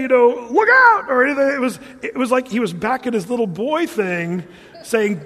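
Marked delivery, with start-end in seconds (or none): 0.5-1.1 high, loud look out; warning shout. Retold or imitated. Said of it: "He didn't say".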